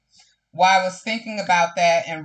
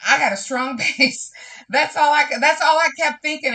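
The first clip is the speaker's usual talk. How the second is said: laughing, high and louder with joy; bursting with gratitude; joyful; 7 semitones higher, 4 dB louder than usual. Real, not acted. To her viewers about her friends, high joyful laugh. Overjoyed at her strong base.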